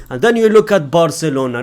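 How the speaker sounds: usual speaking voice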